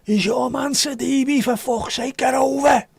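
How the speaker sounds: high, slow, strained fan shout; mock football fan yell. Football fan yelling at a player from the stands. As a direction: bellow it like an outraged fan at the match. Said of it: "Maybe the football one could do with".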